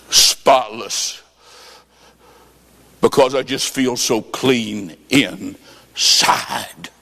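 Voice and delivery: low, slow and tearful. Retold or imitated. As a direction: speak through tears, slowly and low. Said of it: "she starts crying".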